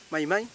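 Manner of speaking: MAI MAI with no r at all. Shown as a demonstration. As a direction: glide MAI MAI, dropping the r. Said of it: "no me diga".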